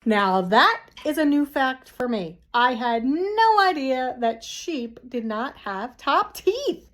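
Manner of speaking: plain reading voice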